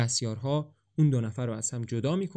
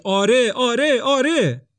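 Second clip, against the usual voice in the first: much higher, much louder cry of joy; joyful shout; 11 semitones higher, 10 dB louder, slower than usual. A narrator's reading. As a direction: shout it out with pure relief.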